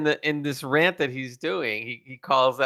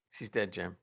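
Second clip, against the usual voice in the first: low, soft deadpan delivery; McCoy impression; 5 semitones lower, 10 dB quieter than usual. Friend doing McCoy to confirm she is dead, low deadpan. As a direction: say it like the ship's doctor, softly.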